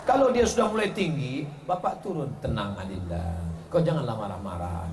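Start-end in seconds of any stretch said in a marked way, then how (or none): 2.4-4.9 much lower, softer placating voice; soft low husband. Retold or imitated. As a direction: soothe her gently and low. Husband soothing wife. (suami membujuk dengan suara rendah dan lembut)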